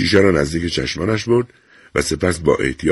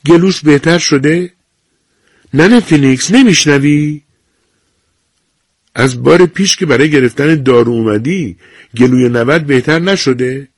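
higher, louder nurse coaxing an answer; nurse voice, impatient; 9 semitones higher, 5 dB louder than usual. A narrator's reading. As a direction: raise your voice to someone who won't reply. Nurse presses old woman.

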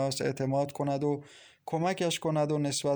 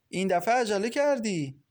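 higher-pitched upset complaint; 7 semitones higher, 3 dB louder than usual. A narrator's reading. Farzaneh protesting to the man who came early, upset. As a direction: complain with open displeasure, voice lifted. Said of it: "با ناراحتی گفت".

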